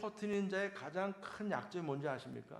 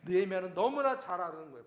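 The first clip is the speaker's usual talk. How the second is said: ordinary talk, nothing marked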